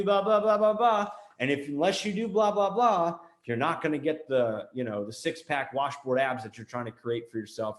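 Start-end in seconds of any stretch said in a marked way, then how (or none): 0.0-3.2 higher-pitched, rattled-off filler chant; mock instructions. Marketer paraphrasing a personal trainer, mock instructions. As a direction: lightly mimic a trainer droning orders.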